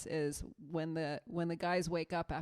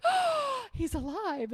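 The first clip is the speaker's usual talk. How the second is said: mock surprise; 7 dB louder, slower than usual. Friends respond in movie scene.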